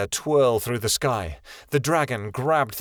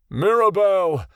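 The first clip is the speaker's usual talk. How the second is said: a higher, louder call of a name; crying out; 7 semitones higher, 5 dB louder, slower than usual. A narrator's reading. Father calling out for his daughter, a loud cry. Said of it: "Ionin cried".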